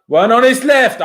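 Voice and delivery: loud, high burst of hype; excited commentary. Real, not acted. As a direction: call it like a big chance is coming. Live commentary, chance building.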